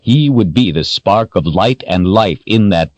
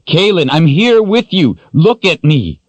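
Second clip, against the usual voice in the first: urgent pleading; 12 semitones higher, 4 dB louder than usual. Acted. Richard urgently calling her.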